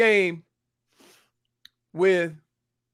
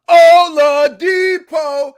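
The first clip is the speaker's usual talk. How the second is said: Oladipo drawn out in a loud high yell; announcer bellowing the player's name after a made shot, loud and drawn out; 9 semitones higher, 11 dB louder, much slower than usual. Retold or imitated. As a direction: shout Oladipo slowly like an announcer. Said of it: "And he just yelled".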